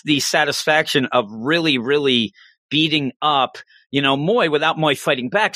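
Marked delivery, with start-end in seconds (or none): none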